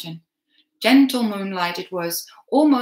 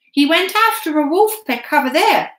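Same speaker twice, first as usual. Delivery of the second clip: put-on raised boy's voice, hurried lie; boy covering; 8 semitones higher, 8 dB louder than usual. A narrator's reading. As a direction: point away and sell it. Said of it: "I said, pointing up the mountain".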